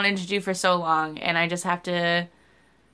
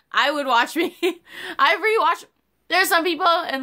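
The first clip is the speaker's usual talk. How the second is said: much higher, laughing through the words; giggly; 10 semitones higher than usual. Real, not acted. Vlogger laughing about rewatching her own videos. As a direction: laugh at yourself as you brag.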